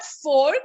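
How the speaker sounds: "fork" with the R sounded; wrong pronunciation. Shown as a demonstration. Teacher repeating the mistake, fork with a clear R. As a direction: say fork with its R, lifting. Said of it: "It is not fork".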